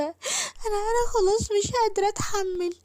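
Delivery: put-on a little girl sobbing to her neighbour; crying girl. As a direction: cry out the words, she can't bear the pain. Sick girl breaks down pleading.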